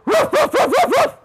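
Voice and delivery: high, rapid imitated barks; dog barking. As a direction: bark like a dog to scare off a cat. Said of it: "And dog".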